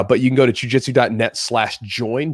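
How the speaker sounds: ordinary delivery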